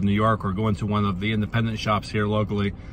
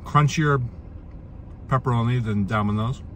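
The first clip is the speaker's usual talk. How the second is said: chewing between words; talking with food in mouth; mouth full of pizza. Real, not acted. Seen 2: Bit pizza at -4s; lips close between words, chewing.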